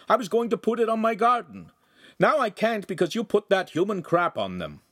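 high, loud furious yell from a window; angry shouting. Acted. Neighbor yelling down from his window at the oaf, furious. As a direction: scold the oaf at full voice. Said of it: "heard the neighbor shouting".

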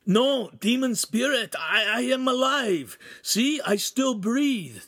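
high, slow, desperate insistence; panicked, loud protest; frantic pleading. Acted. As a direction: plead for your life, drawing out each phrase. Ugolino pleads with demon.

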